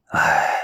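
low, drawn sigh; weary sigh. A narrator's reading. Master sighing at his disciple, low and heavy. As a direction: let out a deep, low sigh. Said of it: "叹了一口气".

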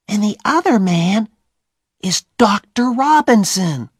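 put-on puzzled boy in the dark; Tom. Tom wondering about the men, high and puzzled.

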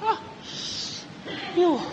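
ouch of surprise. Acted. Stepped-on passenger yelps at the careless rider, startled and pained. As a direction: cry out as if your foot was just stepped on.

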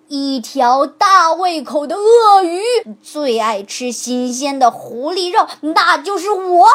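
slow mock crocodile boast; a bone pretending to be fierce; bluffing crocodile. A narrator's reading. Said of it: "骨头回答道". (骨头装成凶猛的鳄鱼吹牛)

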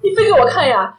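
normal talking voice

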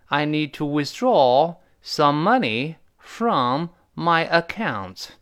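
very slow, clear example with the preposition; slow model reading. Shown as a demonstration. Teacher reads the example with from slowly. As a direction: say "I need to withdraw some money from my account" slowly, stretching "from". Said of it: "后面介词可以加一个from".

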